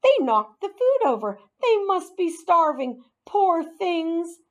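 high-voiced, fretting pity; concerned woman. A narrator's reading. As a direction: fuss sympathetically over hungry pets. Woman fretting over hungry pets.

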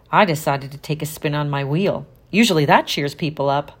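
plain reading voice

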